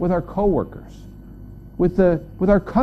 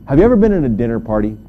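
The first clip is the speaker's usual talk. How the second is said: normal talking voice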